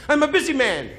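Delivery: high, loud put-on voice of a busy man; gruff busy man voice. Comic quote of a guy who has no time. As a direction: toss it off like an important man brushing you off.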